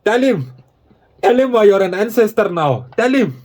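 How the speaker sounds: mock ancestor voice. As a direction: play a bossy colonial ancestor giving orders, lightly hammed. Keeps playing the ancestor.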